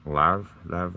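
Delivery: "Love" said as "lahv", questioning; odd pronunciation. Shown as a demonstration. Puzzling over own strange word, "Love" pronounced "lahv". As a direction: say "Love" with an open "ah" vowel, puzzled. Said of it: "that pronunciation was coming from".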